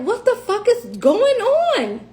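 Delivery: high, slow bewildered outburst; exasperated disbelief. Retold or imitated. Wife fighting for a prison visit, exasperated disbelief. Acting out her bewilderment at officials.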